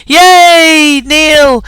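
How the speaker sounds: loud, high, delighted yay; excited greeting. Real, not acted. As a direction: cheer him onto the call. Host greets arriving assistant.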